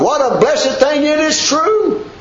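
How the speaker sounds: ordinary talk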